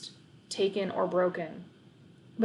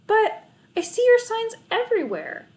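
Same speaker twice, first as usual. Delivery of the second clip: stammered; 6 dB louder than usual. A narrator's reading. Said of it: "I stammered".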